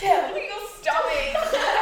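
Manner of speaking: slow, high taunt through laughter; laughing taunt. Retold or imitated. Couple trading playful insults mid-argument, laughing high taunt. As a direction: tease mockingly, laughing. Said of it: "it's like".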